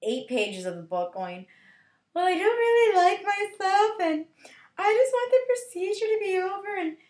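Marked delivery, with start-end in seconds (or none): 2.1-7.0 high, loud mock self-loathing whine; hammed-up self-pity; whining heroine. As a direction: play an insecure teen complaining.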